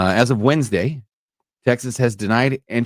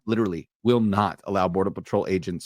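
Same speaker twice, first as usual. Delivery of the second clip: normal talking voice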